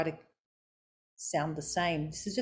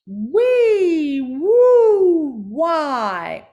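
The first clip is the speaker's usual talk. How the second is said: loud, high, drawn-out swooping vowels; jaw warm-up; 9 semitones higher, 13 dB louder, much slower than usual. Shown as a demonstration. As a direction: call out each word big and high, gliding down at the end. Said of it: "moving your jaw".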